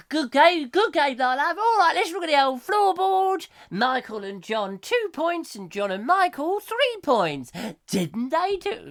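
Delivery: high, loud showman catchphrase voice; Bruce Forsyth impression. Radio host imitating Bruce Forsyth hosting The Generation Game. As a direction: ham up the game show host. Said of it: "and then it".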